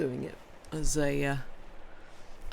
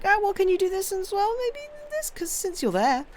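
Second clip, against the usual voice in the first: very high, put-on wheedling voice; mock pestering requester. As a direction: imitate someone squeakily tacking on favours. Mimicking people asking extra favours.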